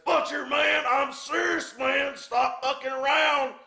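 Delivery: acting out a very high-pitched yell; exasperated shout. Promoter begging Butcher to get serious, exasperated high shout. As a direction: plead at the top of your range, fed up.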